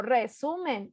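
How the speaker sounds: "resumen" with SU stressed, falling at the end. Shown as a demonstration. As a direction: restate "resumen" as reSUmen. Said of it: "digo resumen".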